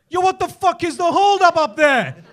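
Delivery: high, loud, impatient yell; angry shouting. Acted. Man next in line to the holdup, impatient shout. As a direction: yell up the queue, fed up. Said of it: "You're shouting at me".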